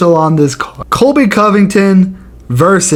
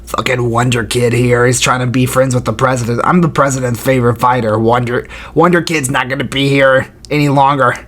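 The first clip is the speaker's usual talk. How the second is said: imitating Colby Covington; 5 semitones lower than usual. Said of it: "He'd be like".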